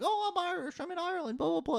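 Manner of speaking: very high-pitched mocking squeal; obnoxious Irish wannabe. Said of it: "they're obnoxious with it".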